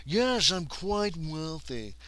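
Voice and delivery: pompous man of means; wealthy gentleman. Acted. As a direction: draw it out like a moneyed toff. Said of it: "He is quite wealthy".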